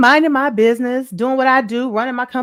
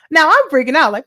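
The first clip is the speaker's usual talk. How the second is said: ordinary talk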